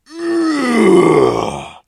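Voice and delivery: very high, loud strained grunt; big straining grunt. Acted. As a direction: give everything on the last curl. Final heavy lift.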